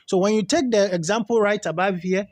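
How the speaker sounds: normal talking voice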